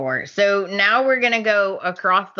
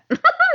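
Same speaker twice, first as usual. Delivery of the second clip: burst of laughter. Real, not acted. Laughing at Hope's burn.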